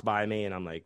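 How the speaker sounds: ordinary delivery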